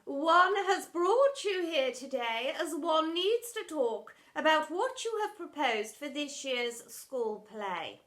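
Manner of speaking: slow, lofty posh headmistress. Acted. Headmistress addressing the drama teacher, slow and lofty. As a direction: speak down like a grand headmistress.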